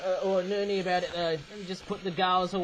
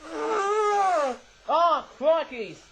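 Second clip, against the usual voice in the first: pained outcry; 10 semitones higher, 5 dB louder, much slower than usual. Real, not acted. Nose trouble, sudden outcry.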